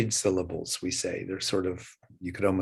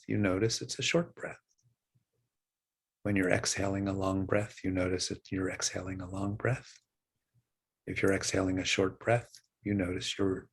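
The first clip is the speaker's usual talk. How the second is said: guided meditation. Acted. Teacher guiding students' breathing, calm meditation voice. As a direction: ease listeners back to the breath.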